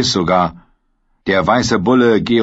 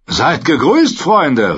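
higher, louder cheerful greeting; friendly call; 7 semitones higher, 4 dB louder, slower than usual. Acted. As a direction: call out breezily to the armed men. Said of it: "rief er ihnen freundlich zu". (freundlich zugerufen)

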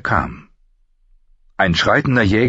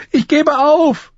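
very high, drawn-out squeal; panicked shriek; 4 dB louder, slower than usual. A narrator's reading. Beaten boy yields on the ground, shrieking. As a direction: shriek your surrender in terror. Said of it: "kreischte er".

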